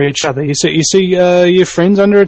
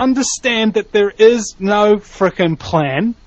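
slow dramatic; 5 semitones higher, slower than usual. Acted. Dramatic slowed declaration.

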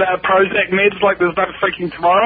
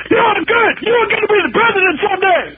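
drill sergeant; 11 semitones higher than usual. Retold or imitated. Drill sergeant mock praise.

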